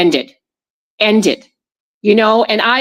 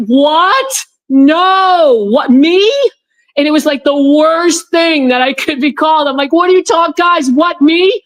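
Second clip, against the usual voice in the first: higher, indignant "what, me?"; startled outrage at the accusation; shocked disbelief; 5 semitones higher than usual. Retold or imitated. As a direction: replay your own stunned protest. Shocked at being called conspiracist.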